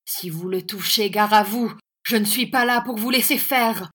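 loud warning, rising in pitch; fierce threat. A narrator's reading. Young teacher defending the boy from the schoolmaster, fierce threat. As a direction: build from warning to heated outburst.

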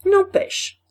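high, loud defiant outburst; loud defiance. A narrator's reading. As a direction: throw it out loudly as a challenge. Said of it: "ajouta très haut Chaval d'un air de défi". (très haut, d'un air de défi)

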